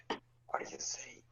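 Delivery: very soft, slow whisper; hushed, drawn-out whisper; in-character whisper. Acted. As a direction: whisper it slowly to the companion beside you. Player whispers in character to companion.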